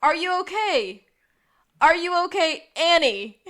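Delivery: high, very loud, each phrase set apart; acted insistent call. Re-enacts Sean's repeated call to Annie.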